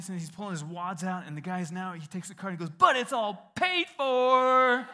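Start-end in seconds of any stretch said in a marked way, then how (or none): 2.8-4.9 high, loud and slow, savoring the reveal; gleeful reveal. Retold or imitated. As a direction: drag it out with delight. Cashier springs the surprise, cooks cheer.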